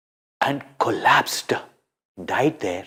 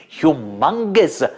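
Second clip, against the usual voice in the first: ordinary delivery, nothing marked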